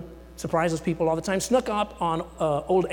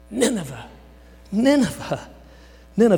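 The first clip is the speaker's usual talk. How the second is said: a hint of disgusted Seinfeld, louder on each Nineveh; Seinfeld saying Newman; 5 semitones higher, 7 dB louder than usual. Preacher imitating Jerry Seinfeld's disgust at Nineveh. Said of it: "Jerry Seinfeld saying Newman".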